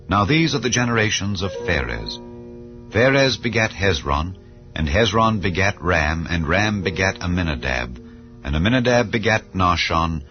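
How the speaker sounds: usual speaking voice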